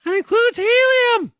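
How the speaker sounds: squeaky high helium, slow and loud. Shown as a demonstration. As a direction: talk like you inhaled a balloon. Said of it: "Every time you do that to your voice".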